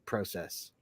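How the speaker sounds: soft, low "process" with long O; Canadian process. Retold or imitated. Admiring the teacher's way, soft Canadian PROH-sess. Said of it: "That just sounds cooler".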